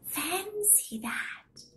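high, slow whispered wonder; whispering old lady. Acted. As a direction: breathe it out like an amazed old woman.

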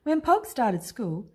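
ordinary delivery, nothing marked

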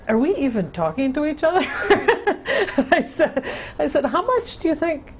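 amused disbelief, laughing as she asks. Real, not acted. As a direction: laugh at how little gets across. Laughing at the translation gap.

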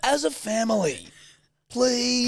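high-pitched mock begging; pleading kid. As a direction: beg like a child. Joins in the child's mock plea.

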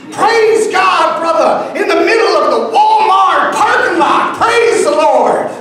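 high, loud praise shouting; the man exulting loud and high; fervent shout. Retold or imitated. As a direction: shout praise across the parking lot. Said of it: "man exults in parking lot".

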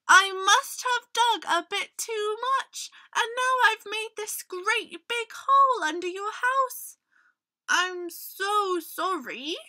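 high-pitched, earnest small creature; apologetic little mole. A narrator's reading. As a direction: squeak out an embarrassed apology.

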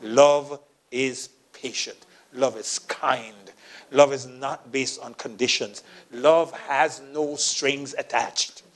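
slow, weighty preaching of love. Acted. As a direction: lay each line down slowly. Preaching at full force.

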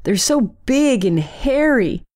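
put-on a girl whining up at her mother, only half shouted; mild yell. A narrator's reading. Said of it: "yelled to her mom".

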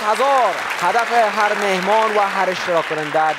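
slow, drawn-out host opening; show host announcement. Acted. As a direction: announce the big prize to the audience.

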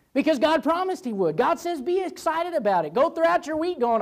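high, excited exhortation; fervent preaching. Real, not acted. Preacher urging congregation to expect blessing, fervent.

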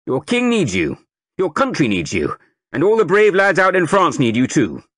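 loud, high recruiting sergeant rousing a crowd; sergeant major. A narrator's reading. Sergeant major rousing the market crowd, booming. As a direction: bellow it to the whole square like a drill sergeant.

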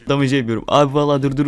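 ordinary delivery

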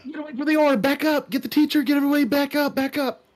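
high frantic yelling; panicked shouting. Acted. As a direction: scream at everyone to back off. Panicked party member yelling retreat.

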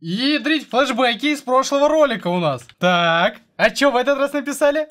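loud, high exasperated outburst. Real, not acted. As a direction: blurt it out in disbelief. Same prank message again. (громко и возмущённо)

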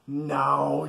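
ordinary delivery

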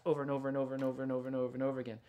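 rattled off at machine speed; rapid repetition. Shown as a demonstration. Artist showing fast repetitive drawing, rattled off very fast. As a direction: race through the repeats like a machine. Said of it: "really fast".

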